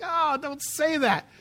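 high, slow mock whine. Teen begging dad to stop, slow high whine. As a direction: drag it out like a sulky teen.

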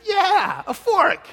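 very high, loud, relieved confirmation; hammed-up high-pitched delight; mock elation. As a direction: squeal it as if finally understood. Retold relief at being understood.

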